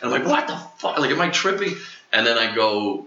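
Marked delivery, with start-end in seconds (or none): 0.2-1.8 very high-pitched, incredulous squeal; retold disbelief. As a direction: act out freaked-out bewilderment, voice shooting up. Retold alarm at seeing scorpion.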